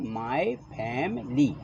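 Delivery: my family said very slowly, every sound drawn out; slow model pronunciation. Shown as a demonstration. Teacher drawing out "My family" slowly for the pupils. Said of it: "क्या बोलते हैं?".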